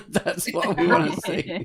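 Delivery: high, loud, laughing; laughing mock man. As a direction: laugh through the mock line.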